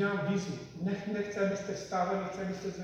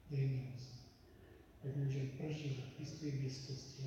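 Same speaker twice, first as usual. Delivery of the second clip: quiet prayer; 5 dB quieter than usual. Acted. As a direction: pray softly and earnestly. Leads a long prayer. (tichá modlitba)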